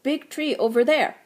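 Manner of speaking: a curt frog pointing the way. A narrator's reading. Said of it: "croaked the frog".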